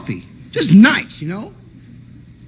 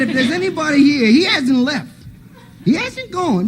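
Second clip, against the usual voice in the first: high suspicious uncle; 10 semitones higher than usual. Retold or imitated.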